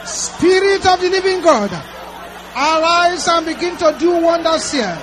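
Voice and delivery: high, loud shouted deliverance prayer; fiery preaching. Real, not acted. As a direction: command the darkness out at full force. Deliverance prayer shouted at full force.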